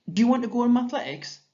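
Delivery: put-on high, fast, lively child's question; voicing Esther. Shown as a demonstration. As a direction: read it as an eager big sister asking.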